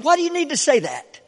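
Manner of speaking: ordinary talk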